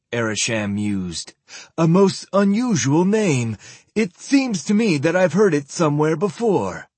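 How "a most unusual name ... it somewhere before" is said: put-on old zealot voice. Zealot muses.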